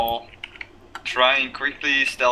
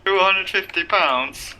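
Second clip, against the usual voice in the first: high, slow announcer call; ring announcer; 7 semitones higher, slower than usual. Pretend. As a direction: stretch it out like a boxing announcer. Mock ring announcer intro.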